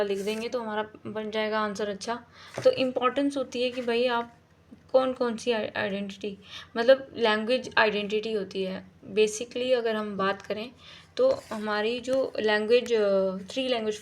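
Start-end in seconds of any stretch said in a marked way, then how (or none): none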